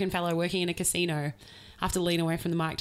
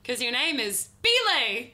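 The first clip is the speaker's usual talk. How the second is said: higher, slower, punched name; mock shouting the name; 6 semitones higher, slower than usual. Friend explaining he shouts because of the name, higher. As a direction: proudly call out the name. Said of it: "he's like".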